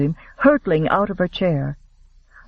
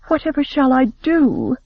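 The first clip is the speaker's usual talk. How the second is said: anxious plea; 8 semitones higher than usual. Acted. Anxious girl asks her chaperone.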